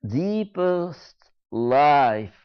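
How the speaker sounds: ordinary talk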